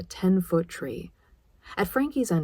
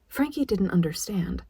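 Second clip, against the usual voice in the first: ordinary delivery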